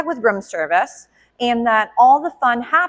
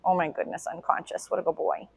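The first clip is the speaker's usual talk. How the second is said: soft, low whisper over a sleeping dog; hushed whisper; 4 semitones lower, 10 dB quieter than usual. Real, not acted. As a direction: whisper so he stays asleep.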